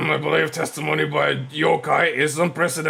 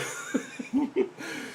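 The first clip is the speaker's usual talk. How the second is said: high cackle; 8 dB quieter than usual. Acted. Judge laughs.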